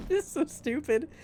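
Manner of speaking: giggling high; laughing. Real, not acted. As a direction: say it through giggles. Laughing at own idea.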